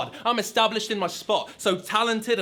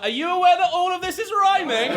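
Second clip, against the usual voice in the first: higher, louder gawping bystander; mock bystander; 9 semitones higher, 5 dB louder than usual. Guy on the side of the stage, high and amazed. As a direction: sound amazed like a clueless fan. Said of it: "Guy on the side, like".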